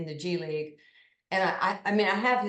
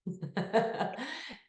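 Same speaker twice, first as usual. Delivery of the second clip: a short, high, breathy laugh; embarrassed laugh; 5 semitones higher, 5 dB quieter than usual. Real, not acted. Laugh after hushing Nick.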